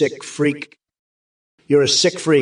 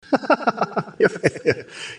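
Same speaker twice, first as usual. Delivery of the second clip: long, high cackling fit; high laughter tapering down; freakish laugh; 6 semitones higher, 3 dB quieter than usual. Real, not acted. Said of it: "You're laughing like a freak".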